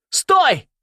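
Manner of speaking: high, loud urgent shout. A narrator's reading. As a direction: shout to stop him at once. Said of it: "крикнул он". (резкий высокий крик)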